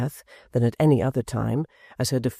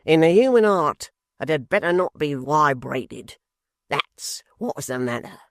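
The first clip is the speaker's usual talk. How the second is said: pompous apprentice; 2 semitones higher than usual. A narrator's reading. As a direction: make every word weighty. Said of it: "said Mister Tappertit".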